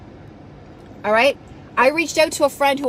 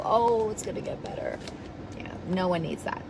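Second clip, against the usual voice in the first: mock pity; 5 semitones lower, 9 dB quieter than usual. Mock consoling of poor you.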